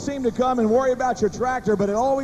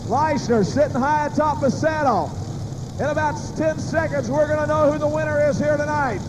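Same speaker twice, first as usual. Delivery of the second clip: building excited race call; live play-by-play; 3 semitones higher than usual. Acted. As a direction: call the final run with rising suspense. Call of final puller.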